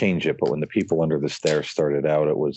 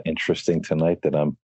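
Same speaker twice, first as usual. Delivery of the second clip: ordinary talk, nothing marked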